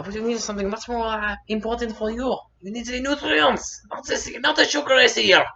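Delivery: high-pitched mum explaining; insistent motherly correction, high; lecturing mother. Retold or imitated. Voices mother lecturing child.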